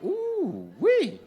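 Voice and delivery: very high squealing ooh wee; high excited ooh wee. Acted. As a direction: squeal at her looks. Men react to thick woman.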